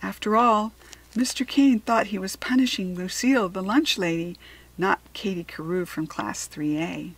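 usual speaking voice

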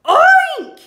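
squealed high, loud oink; hog oink. A narrator's reading. Hog hears of the plot, loud high oinking. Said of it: "said the hog".

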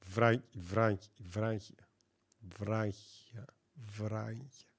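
very soft, low repetitions of the word; hushed sounding-out. Shown as a demonstration. Streamer trying out the word's pronunciation to himself, hushed.

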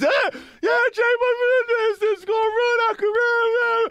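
very high, loud whiny wail; mock crying. Young rappers complaining to their DJ, mock crying. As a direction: bawl it like a protesting kid. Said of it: "we crying and stuff like that".

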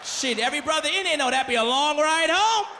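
usual speaking voice

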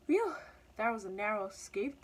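mildly relieved exclamation; a puff of relief after escape; lightly voiced relieved cat. A narrator's reading. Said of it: "thought the cat, covering at the top".

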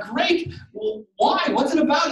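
high, loud, hammed-up interest; mock eager reply. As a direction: play up fake enthusiasm.

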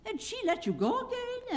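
high-pitched astonished question; wonder. A narrator's reading. The guardian asking the travelers in high wonder. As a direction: ask it amazed, way up high. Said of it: "asked the man in wonder".

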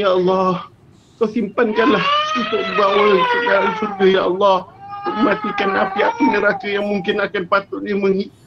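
fervent doa, voice cracking into sobs; tearful prayer. Real, not acted. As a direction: plead with God, near tears. Closing prayer continues after aside. (doa penuh sebak dan rayuan)

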